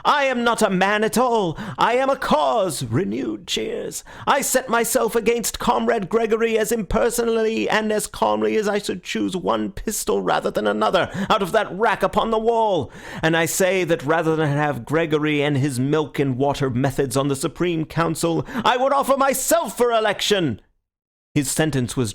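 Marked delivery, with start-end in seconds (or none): 0.0-2.8 high, forceful declaration to a crowd; fiery oratory. A narrator's reading. Syme's tirade between cheers. 4.3-20.6 high-pitched political speech, rising to a climax; uncompromising tirade. A narrator's reading. Tirade grows more uncompromising.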